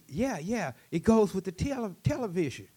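high, loud, excited explaining; eager trader. Acted. Trader explaining his VCR to the chief, eager and excited.

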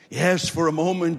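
normal talking voice

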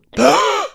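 sharp shocked gasp; loud high gasp. Pretend. Role-play suspect gasps.

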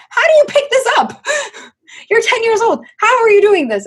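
very high, loud incredulous mother; imitated flabbergasted parent; aghast mom. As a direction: shriek in disbelief at your kid.

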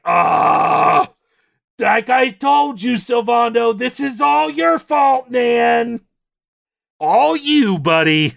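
high, slow, hammed-up blame; mock accusing shout. Hero yelling at Sylvando that it's all his fault, mock shout. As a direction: point the finger and yell it playfully. Blaming Sylvando, second louder take.

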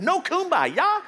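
high, loud, drawn-out incredulity; mock disbelief. Mock disbelief at missing camp song.

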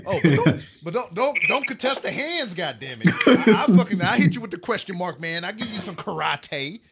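loud, high, laughing fake anger; laughing mock yell. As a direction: yell it at him while cracking up. Mock anger at chat joke.

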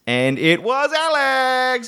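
very high, slow, gloating announcement; triumphant reveal. Real, not acted. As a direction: crow that you were right.

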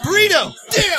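shout. Pretend. Calling out to the armadillo.

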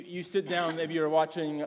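normal talking voice

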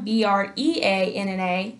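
letter by letter: B R E A N N A; spelling it out. Shown as a demonstration. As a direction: spell B R E A N N A one letter at a time. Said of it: "I'm at Breanna".

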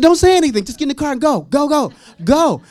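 loud, high panicky command; urgent order. Retold or imitated. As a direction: hurry them off before they can ask. Acts rushing friends into car.

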